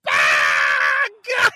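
drawn-out agonized yell; hammed-up howl of pain; acted pained scream. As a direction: scream as the swab goes up your nose. Acts out pain of nasal swab.

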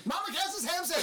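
race caller. Pretend. Mock race call.

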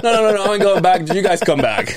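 high, loud, urgent pleading; mock protest. Protesting to his family outside, high and loud.

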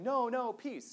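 mild mock protest yell, pitched high. Lecturer imitating yelling peace activists, high half-hearted yell. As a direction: imitate a protester's cry without really shouting. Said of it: "peace activists who are yelling".

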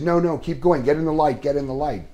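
urging director. Retold or imitated. Director urging the actor to continue, high and eager. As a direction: hurry him back into the light.

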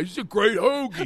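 hammed-up Philly guy raving about food; mock Philly sandwich fan. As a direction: imitate an oblivious, delighted Philly eater. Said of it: "these Philly guys".

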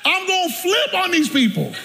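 high-pitched comic threat on FLIP; mock outburst. Preacher about to flip on his relatives, high mock outburst. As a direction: let the pitch leap as if losing it.

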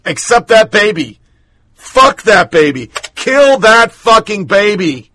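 hammed-up callous sneer at the baby; mocking the world. Host mocking the world's hypocrisy, hammed-up sneer. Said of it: "The entire world goes".

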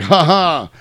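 spoken cackle of a gloating villain; mock villain laugh. Paul gloats at the brothers over the bathtub, mocking laugh. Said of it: "He's like".

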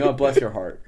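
put-on old Southern lady voice. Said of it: "like an old Southern lady saying".

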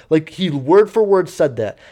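usual speaking voice

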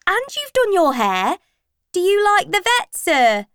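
put-on high, loud teasing teenager; cheeky schoolboy. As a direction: push the joke further. Pupil teasing the teacher.

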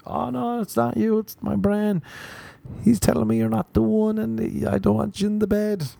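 high, pleading explanation; hasty apology. Acted. Sleeper explaining the brain to a bedmate, high and apologetic.

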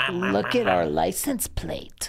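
ordinary talk, nothing marked

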